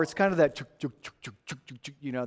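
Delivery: soft imitated chuk-chuk of a little engine; quiet train chugging. Preacher imitating a little engine chugging, softly. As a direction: puff out the train noise under your breath.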